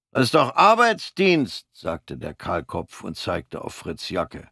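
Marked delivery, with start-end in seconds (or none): 0.1-1.7 high, sneering soldier's voice; mocking corporal. Bald corporal mocks Fritz's odd uniform, high and sneering. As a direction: needle the newcomer from on high.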